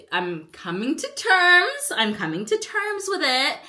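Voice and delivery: high, loud, drawn-out mock conviction; hammed-up acceptance. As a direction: overdo the claim of having accepted it. Hammed-up insistence on being over it.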